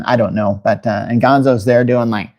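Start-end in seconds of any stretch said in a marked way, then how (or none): none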